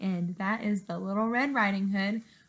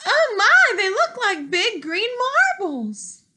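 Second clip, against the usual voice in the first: put-on little girl; 11 semitones higher, 5 dB louder than usual. Girl marvels at eyes.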